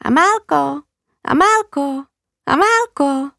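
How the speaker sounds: lightly voiced budgie calling her name; parrot calling. Budgie in the cage calls Amálka's name. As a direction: chirp the name like a talking bird. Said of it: "se z klece ozvalo".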